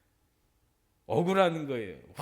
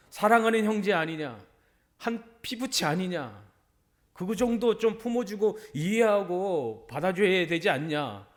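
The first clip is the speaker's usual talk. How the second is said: raised, higher scolding voice; scolding father; 4 semitones higher, 6 dB louder than usual. Retold or imitated. Father scolding his resentful eldest son, raised and reproachful. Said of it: "그렇게 막 혼내며".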